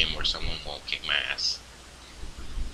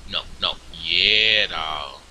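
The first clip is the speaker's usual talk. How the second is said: ordinary delivery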